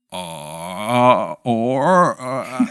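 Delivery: imitating a baffled, drawn-out "uh"; mock stumped hesitation; confused fumbling. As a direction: grope for the word as a puzzled barista. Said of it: "The rest of the time, they're like".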